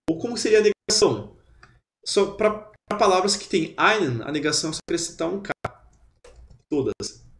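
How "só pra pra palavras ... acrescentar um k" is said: ordinary talk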